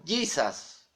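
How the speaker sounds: "Jesus" said "YEE-sas", Spanish-accented English; mispronounced English name. Shown as a demonstration. Preacher quoting the gringo mispronunciation, "Jesus" as "YEE-sas". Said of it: "trayendo una mala pronunciación".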